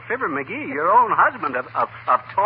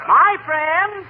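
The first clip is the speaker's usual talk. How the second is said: lofty, higher pitched oratory; quoting Washington; 10 semitones higher, 4 dB louder than usual. Acted. McGee playing Washington for Molly, grand. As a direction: ring it out like a general. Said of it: "he says".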